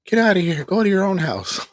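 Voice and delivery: high, loud mock of an angry mother; mom's angry retort. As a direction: shout it like mom kicking her out, mocked. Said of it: "she gets mad at me and goes".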